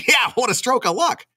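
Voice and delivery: high-pitched, laughing delight; gleeful punchline. Acted. Man rid of his mother-in-law, gleeful. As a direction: land the gag through a laugh.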